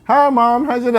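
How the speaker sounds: high, loud put-on kid greeting; child greeting mom. Kid greeting mom as she comes home, high acted voice. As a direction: call it out high and innocent.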